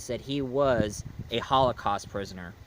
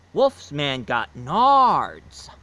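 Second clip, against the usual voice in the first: slow announcer; 5 dB louder, much slower than usual. Retold or imitated. Slow-motion announcement of kick.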